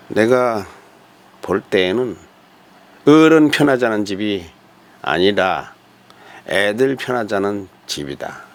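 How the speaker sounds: ordinary talk, nothing marked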